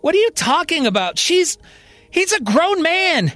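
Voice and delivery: high-pitched, baffled protest; confused frustration. Acted. Johnny defending himself to Marion, confused and frustrated. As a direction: protest in exasperation, pitch climbing. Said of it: "Johnny is confused and frustrated".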